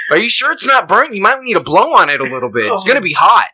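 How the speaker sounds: hammed-up scared onlooker at a pepper; mock alarmed onlooker. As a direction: fuss over the pepper in fake alarm.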